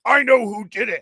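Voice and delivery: high, loud accusing quote; retold outburst. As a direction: raise the voice as the boss calling them out. Retold angry boss confronting staff.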